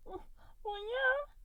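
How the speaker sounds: guilty little blob squeaking out an answer. A narrator's reading. Goo squeaking nervously to Mike. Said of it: "he squeaks".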